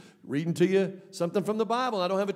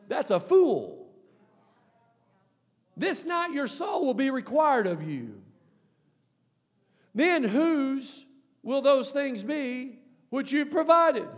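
high, slow preaching cry; raised, measured thunder; forceful pronouncement of judgement; 8 semitones higher, 4 dB louder, slower than usual. Acted. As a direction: proclaim God's verdict, drawing it out.

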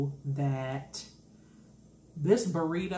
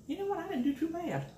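pleased high voice; 12 semitones higher, faster than usual. Real, not acted. Pleased with her rolled burrito.

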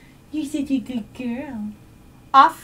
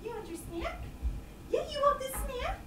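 very high, soft squeak; squeaky praising a dog; 13 semitones higher, 16 dB quieter than usual. Real, not acted. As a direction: coax with a tiny squeak. Coaxing dog.

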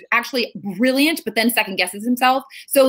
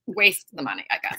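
muttered relief; 6 semitones lower, 4 dB quieter than usual. Retold or imitated. Parents' grudging relief, retold.

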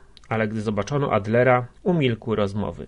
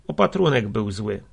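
plain reading voice

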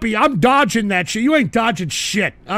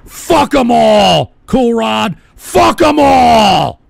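loud, long drawn-out bellow; defiant shout; 6 dB louder, much slower than usual. Real, not acted. Host backing a donor against the haters, defiant bellow. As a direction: roar it slowly as a battle cry. Rallying with a supporter.

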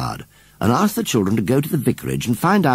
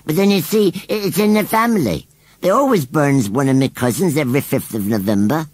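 high, louder country scarecrow, matter-of-fact; rustic scarecrow voice; 6 semitones higher, 3 dB louder than usual. Acted. Scarecrow explains his family burnings to Susan, plainly. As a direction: chatter on like a simple old scarecrow.

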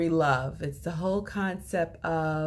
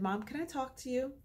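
softer, hushed plea to mom; quiet request; 4 semitones higher, 10 dB quieter, faster than usual. Retold or imitated. Son pulling his mom aside to talk, softly and hushed. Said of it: "very quiet".

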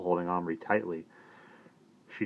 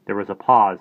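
usual speaking voice

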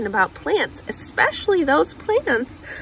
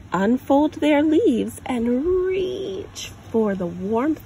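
put-on reading to a child. Parent reading a picture book aloud, gentle storytelling. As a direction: read it like a picture book for a little one.

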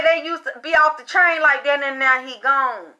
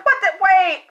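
retold shock; 7 semitones higher than usual. Retelling her shocked reaction.

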